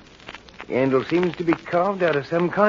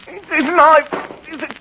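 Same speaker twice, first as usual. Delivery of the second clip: high, raised, frantic; desperate blame; 9 semitones higher, 4 dB louder than usual. Acted. Henry blaming the knife for Millie's death, frantic. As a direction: cry out against the cursed thing.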